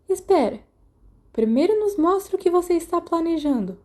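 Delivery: urgent demand. Acted. Sirius grabbing James's arm, urgently demanding to see the plan. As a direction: grab the moment and demand the plan.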